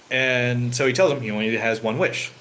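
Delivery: ordinary delivery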